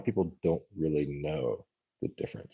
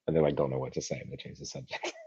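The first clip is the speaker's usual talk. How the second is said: ordinary talk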